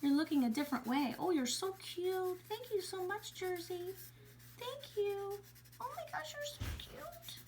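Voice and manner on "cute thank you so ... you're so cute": high, slow, soft cooing. Real, not acted. Teacher gushing to the bunny Jersey, high cooing.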